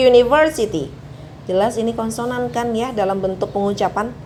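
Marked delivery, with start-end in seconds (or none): 0.1-0.9 university starting with a clear you sound, a bit higher; pronounced university. Shown as a demonstration. Teacher showing the you sound at the start, careful. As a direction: model university for the class. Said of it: "Kalau U tulisannya seperti ini".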